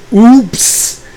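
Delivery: a cartoonish little uh-oh; mock oops. Reacting on day one to meeting the roommates, comic high oops. Realizing roommates are a bad fit.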